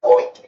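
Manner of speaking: high, loud imitated yelp; short squealed outburst, mimicked; imitated startled yelp. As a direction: act out the noise he made, sudden and high. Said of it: "went like this".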